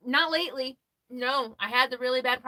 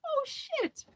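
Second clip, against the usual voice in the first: high, hushed startled oh shit; shocked oh shit; 4 dB quieter, much slower than usual. Retold or imitated. Seeing the red shirt, a high startled oh shit. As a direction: gasp it out like you just realised. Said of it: "I was like".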